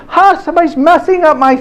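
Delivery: high-pitched mock indignation; indignant little daughter. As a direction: imitate an upset little girl complaining. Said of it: "Abigail comes down and she goes".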